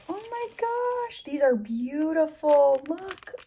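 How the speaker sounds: high, loud, slow gush of delight; delighted squeal. Real, not acted. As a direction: swoon over something beautiful. Gushing over hair ties.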